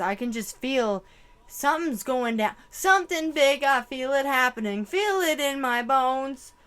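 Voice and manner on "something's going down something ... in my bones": rising louder and higher with urgency; building intensity. Real, not acted. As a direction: build it up as dread grows. Foreseeing something big.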